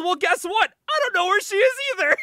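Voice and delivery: high, loud hammed-up shout; exasperated retort. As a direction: blurt it out in mock frustration. Shouted reply in acted quarrel.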